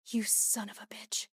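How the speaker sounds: bitter whisper. A narrator's reading. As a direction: breathe the curse out in a whisper. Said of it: "I whispered".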